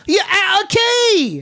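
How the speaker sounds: high, drawn-out British heckle; mock British accent. Mocking the British schoolmate's music critique, mock British accent. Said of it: "he would be like".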